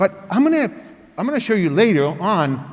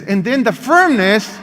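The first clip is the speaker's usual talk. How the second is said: ordinary delivery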